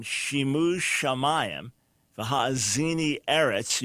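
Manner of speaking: "Shimu shamayim" slow, sh sounds stressed; alliteration shown. Shown as a demonstration. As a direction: savor the matching "Shim" and "sham". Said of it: "So you hear the Shim Sham".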